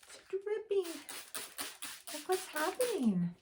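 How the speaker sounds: put-on delighted ooh for the kids; excited wonder. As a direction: marvel at the magic. Magic umbrella reveal.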